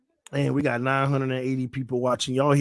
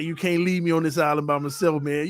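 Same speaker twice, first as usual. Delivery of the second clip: usual speaking voice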